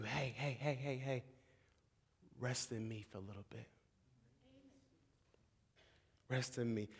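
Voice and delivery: soft, hushed, soothing voice; God's tender comfort. Acted. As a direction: murmur it like calming a frightened child. God comforting the broken sheep.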